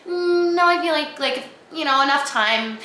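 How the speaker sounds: high-pitched mock inner voice, easing down; delusional self-talk. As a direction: play your own deluded inner voice. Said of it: "my delusional head that was like".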